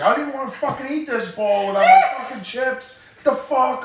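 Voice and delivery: high, loud furious rant; mock fury over missing chips; pissed off. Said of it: "he was like".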